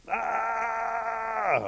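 loud, high, sustained wail; imitated scream. Deer showing bear the weird noise, imitated wailing scream. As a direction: mimic a weird far-away scream. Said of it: "Sounds like this".

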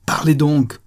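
loud, high exasperated order; impatient cry. A narrator's reading. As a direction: cry out the command to speak. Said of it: "s'écria-t-il".

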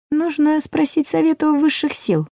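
high sly dwarf voice. A narrator's reading. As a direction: lift into a small sly servant. Dwarf character voice.

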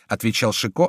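ordinary delivery, nothing marked

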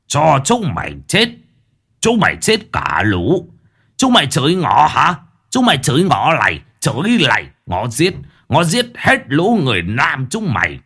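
furious shouting in broken Vietnamese; broken foreign accent. A narrator's reading. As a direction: rage at them with a thick foreign accent. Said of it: "tiếng mắng chửi bằng cái giọng lơ lớ".

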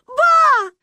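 high, loud tearful wail; crying out. A narrator's reading. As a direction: wail for grandma. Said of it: "плакала Маня". (плачущий крик)

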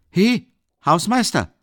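loud, high, drawn-out hail; calling out. A narrator's reading. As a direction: hail the caretaker from across the way. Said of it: "rief er".

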